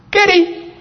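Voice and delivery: calling the name out in a bad Puerto Rican accent. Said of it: "I'm trying to speak in Puerto Rican accent".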